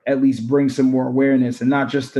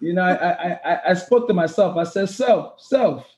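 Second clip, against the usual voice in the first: put-on a clumsy Caribbean accent pitched high; attempted Caribbean accent; 7 semitones higher than usual. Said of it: "my new accent".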